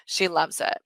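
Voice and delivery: ordinary delivery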